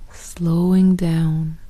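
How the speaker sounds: ordinary talk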